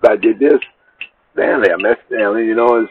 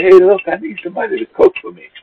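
imitating her on the phone, pitched much higher; woman's voice; 12 semitones higher, faster than usual. Woman calls the next day asking him to cook, imitated high. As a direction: put on her sweet-talking phone voice. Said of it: "she called me the next day".

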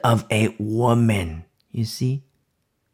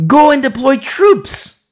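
much higher, louder, slow command; 12 semitones higher, 8 dB louder, slower than usual. Retold or imitated. The Lord commanding Barak, loud and slow. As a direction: ring it out like an order. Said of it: "the Lord commanded".

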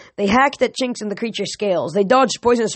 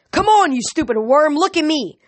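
taunting shout; 8 semitones higher than usual. A narrator's reading. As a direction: yell to draw its attention. Taunting the monster.

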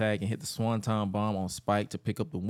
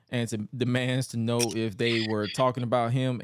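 normal talking voice

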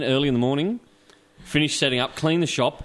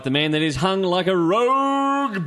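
slow, drawn-out announcer boast; slow mock-epic announcer; 9 semitones higher, 3 dB louder, much slower than usual. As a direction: ham up the guest intro. Host's mock-grand intro of guest.

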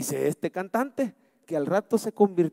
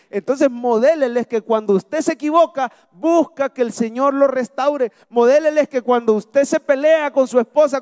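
raised, louder preaching voice; fervent sermon exhortation; preaching at full force; 6 semitones higher, 4 dB louder than usual. Acted. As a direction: press the point home from the pulpit.

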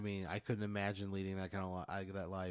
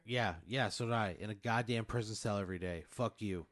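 normal talking voice

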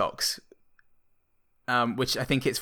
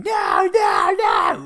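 high, loud anguished screams; screaming no; 6 dB louder, much slower than usual. Retold or imitated. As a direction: scream it as the ham is crushed. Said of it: "J L C screaming".

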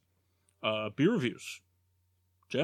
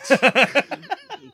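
loud laugh; 12 semitones higher, 11 dB louder than usual. Real, not acted. Big laugh at Jeff's joke.

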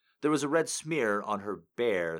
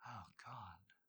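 whispered oh God, much softer; hushed exclamation; 22 dB quieter than usual. Acted. Maya reacting to Dean's touch, soft whisper. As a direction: let it slip out as a whisper.

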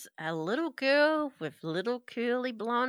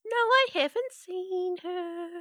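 put-on creepy little girl=85; 9 semitones higher than usual.

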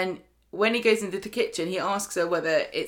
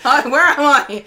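loud giggly confusion; laughing hard while lost; 8 semitones higher, 13 dB louder than usual. Real, not acted. Lost place, laughing loudly.

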